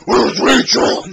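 high, loud, mock-angry roar; hammed-up angry movie yell; Batman yell. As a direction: roar it like an overwrought masked hero. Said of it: "which we constantly yell all the time".

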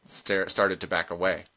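usual speaking voice

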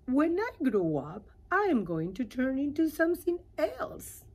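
little caterpillar voice, eager. Acted. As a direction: share a big secret brightly. Caterpillar tells her secret.

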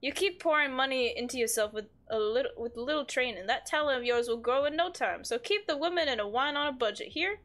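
usual speaking voice

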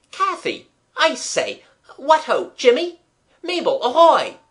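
high, loud hearty hail; loud, cheerful. A narrator's reading. Boy hailing his frightened friends from the hall, loud and cheerful. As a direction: call out brightly to friends in another room. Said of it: "cried in a loud, cheerful voice".

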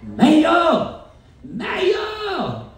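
high, long-drawn call. A narrator's reading. Horton calling the mayor on the speck, calling out. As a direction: holler up high for someone far away.

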